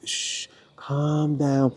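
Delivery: soft and very slow shushing; soft soothing hush. Shown as a demonstration. Parent calming a crying baby, soft slow shush. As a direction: hush it gently, stretching it out. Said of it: "you're like".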